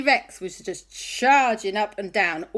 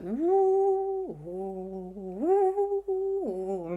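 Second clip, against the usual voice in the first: ghostly woo; 9 semitones higher, much slower than usual. Acted. Ghosts hooting.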